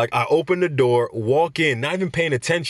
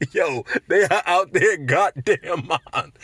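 ordinary talk

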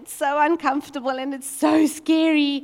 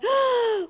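sharp high gasp; mock anxious gasp; nervous gasp; 12 semitones higher than usual. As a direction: gasp as if struck by worry. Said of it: "And then I went".

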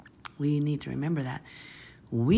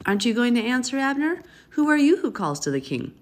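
high, loud call across the valley; shouting across; 7 semitones higher, 4 dB louder than usual. A narrator's reading. David shouting from the mountaintop to Abner, high and loud. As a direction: call out loud over the distance. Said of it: "David shouted to the troops".

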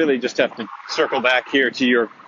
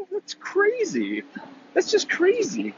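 much higher, slow, stretched disbelief; incredulous; slower than usual. Acted. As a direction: squeal it in mock disbelief. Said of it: "mock disbelief at Nick's plan".

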